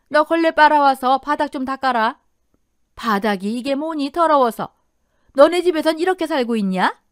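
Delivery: high, loud, bossy mother-in-law; scornful scolding. A narrator's reading. Mother-in-law orders daughter-in-law to wipe the floor, scornful scolding. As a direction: order her about and find fault.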